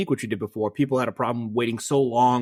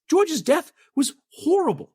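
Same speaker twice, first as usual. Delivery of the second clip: high-pitched, slow, drawn-out protest; appalled, voice pitched up high; real indignation; 13 semitones higher than usual. Real, not acted. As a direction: lament it emphatically, voice way up high. Indignant over favourite character's death.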